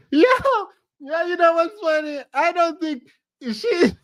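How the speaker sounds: very high voice breaking into laughter; laughing. Real, not acted. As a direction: try to talk while cracking up. Cracking up at the clip.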